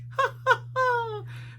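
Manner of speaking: high cartoon giggle; goofy high laugh. A narrator's reading. Shaggy giggling over lunch on the lift, high and silly. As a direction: giggle like a hungry goofball.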